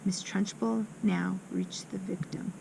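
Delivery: ordinary delivery, nothing marked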